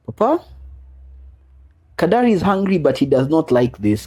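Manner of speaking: put-on high childlike voice; lightly voiced young daughter; daughter's voice. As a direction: chirp it like a little girl. Father voicing his daughter.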